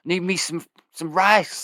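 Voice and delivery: high, weak pleading for food; starving person voice. Acted. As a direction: beg for rice like you're starving.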